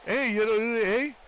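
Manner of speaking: mock a thick Ontario hockey-guy drawl; Ontario accent. Streamer mocking the Shoresy Ontario accent, Ontario accent. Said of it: "Like the Shoresy accent".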